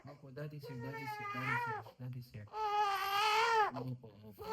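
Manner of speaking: very soft, tender reassurance. Real, not acted. Daddy to crying baby at a shot, soothing.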